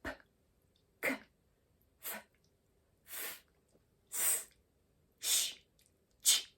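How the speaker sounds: breathy unvoiced consonants, just air; teacher pushes out each voiceless sound as pure air. Shown as a demonstration. Teacher demonstrating voiceless consonants, only air from the mouth.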